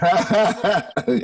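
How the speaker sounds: short bright laugh falling away; amused laugh. Real, not acted. Laughs at joke threat.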